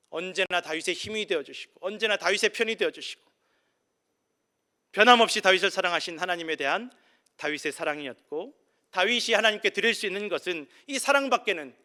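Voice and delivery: swelling preacher's fervor; preaching at full force. Acted. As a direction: proclaim it to the whole church. Loud preaching climax.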